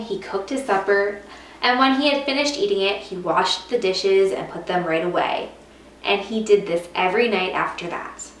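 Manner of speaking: ordinary delivery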